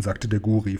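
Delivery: ordinary delivery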